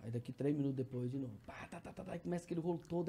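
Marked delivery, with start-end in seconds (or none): 1.4-2.1 very soft, voiceless stutter of syllables; barely sounded repetitive tic; imitated tic starting. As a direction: let the syllables tick out under the breath. Acting out tics returning.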